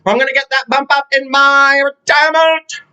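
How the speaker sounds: high, stubborn made-up voice; high mock stubborn vow, slowing at the end; mock mother impression. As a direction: dig in like a determined mom. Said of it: "It's not how she talks".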